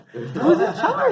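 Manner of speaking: high and slow, laughing as he asks; a playful, hammed-up plea asking who runs things; laughing mock outrage. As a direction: stretch the question out through a laugh. Rhetorical exasperation, laughing.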